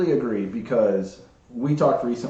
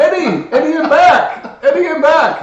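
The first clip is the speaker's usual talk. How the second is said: loud, high singsong holler; calling out; 11 dB louder, slower than usual. Acted. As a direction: announce your return at full volume, stretching the words. Acts out calling to Eddie.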